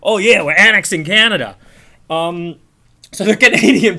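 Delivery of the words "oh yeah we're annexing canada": mocking boast. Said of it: "What are you, Putin?".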